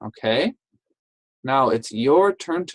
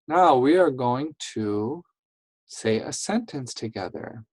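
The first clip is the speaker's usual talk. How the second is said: ordinary talk, nothing marked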